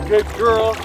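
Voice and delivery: usual speaking voice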